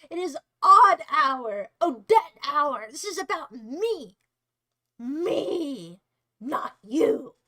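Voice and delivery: loud, slow, sassy self-centred diva. Pretend. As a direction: flip the hair and claim the spotlight, slowly. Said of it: "me sassily swishing my hair".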